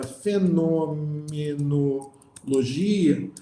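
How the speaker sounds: "fenomenologia" bem esticada, sílaba por sílaba; palavra desmembrada. Shown as a demonstration. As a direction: stretch "fenomenologia" out slowly, piece by piece. Desmembra a palavra que explica. (palavra desmembrada, devagar)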